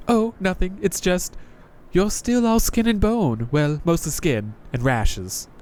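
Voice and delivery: a jaded knight greeting a newcomer; Crestfallen Knight voice. Acted. Knight mocks looks.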